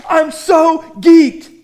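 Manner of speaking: thrilled. Retold or imitated. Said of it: "Paul's going".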